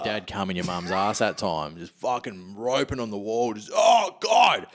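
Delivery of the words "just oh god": high, loud hammed-up climax cry; mock orgasm moan. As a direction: overdo it, higher and louder.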